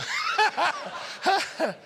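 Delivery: high burst of laughter. Real, not acted. Laughs at own shock imitation.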